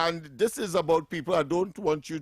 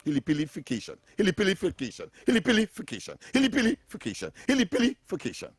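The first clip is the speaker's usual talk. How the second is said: Hilipilification repeated, each take pitched higher; building repetition; 3 semitones higher, faster than usual. Shown as a demonstration. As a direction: drill the word Hilipilification, rising each time. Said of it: "building repetition".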